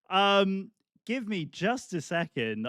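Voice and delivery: usual speaking voice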